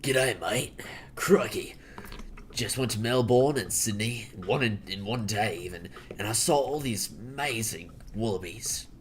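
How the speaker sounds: hammed-up Aussie accent; mock Australian accent. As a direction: play an Australian recounting a trip. Performs an Australian character on stream.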